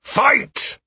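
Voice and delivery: high-pitched call to fight; one sharp yell across the field; shouted command. Acted. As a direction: shout it as an order. Trainer orders the bout to continue.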